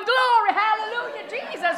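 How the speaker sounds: high, loud rejoicing with a laugh; jubilant praise shout. Retold or imitated. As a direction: shout your praise at the top of your voice. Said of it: "I was going".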